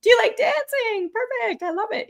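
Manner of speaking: very high, gushing approval; playful high-pitched delight. Acted. Delighted reaction to chat suggestion.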